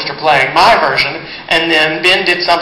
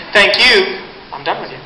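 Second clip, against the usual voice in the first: ordinary talk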